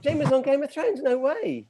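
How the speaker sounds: high-pitched feigned disbelief; mock amazement. Host teasing a colleague about Game of Thrones, mock amazement. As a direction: act astonished at the news, pitched well up.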